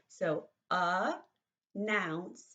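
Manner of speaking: announcement said slowly, syllable by syllable; clapped syllables. Shown as a demonstration. Teacher clapping syllables for the class, slow and even. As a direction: clap out announcement in three slow beats. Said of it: "we're gonna clap".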